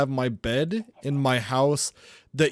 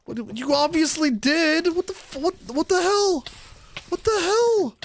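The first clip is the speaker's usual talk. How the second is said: outraged yelling; 13 semitones higher than usual. Real, not acted. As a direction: yell at the betrayal. Finds his island destroyed.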